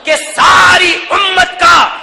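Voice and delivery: impassioned majlis oratory; rousing preaching. Real, not acted. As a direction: build each phrase to a ringing peak. Preacher builds to a climax.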